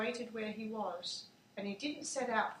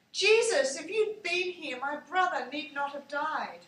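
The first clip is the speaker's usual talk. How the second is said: raised, pleading voice breaking out on Jesus; pleading cry; 4 semitones higher, 7 dB louder than usual. A narrator's reading. Mary at his feet begging Jesus, raised pleading. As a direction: cry out to him in grief.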